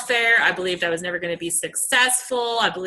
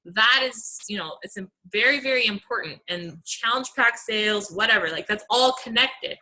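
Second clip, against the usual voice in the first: normal talking voice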